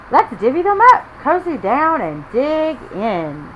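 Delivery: eager little badger inviting friends to eat; cheerful Badger. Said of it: "Badger says with a grin".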